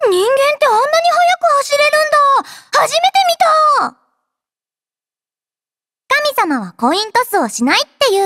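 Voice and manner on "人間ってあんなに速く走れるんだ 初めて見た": high, astonished exclamation; wide-eyed wonder, pitched up; amazed. Acted. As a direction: gasp out how fast he ran. Commander runs away fast.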